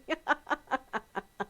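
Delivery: high run of chuckles; real laughter. Real, not acted. Admitting it is her own habit, real laughter. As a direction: laugh at your own fib.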